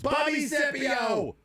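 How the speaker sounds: high, stretched-out holler; slow, very high playful shout; yelling texter. Acted. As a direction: yell out the name like an all-caps text. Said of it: "The texter yells".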